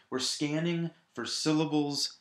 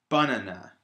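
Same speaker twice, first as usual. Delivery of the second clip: "banana" as BAH-nuh-nuh, first syllable loud; stress on first syllable; 2 semitones lower, 5 dB louder than usual. Shown as a demonstration. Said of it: "put the stress on the first syllable".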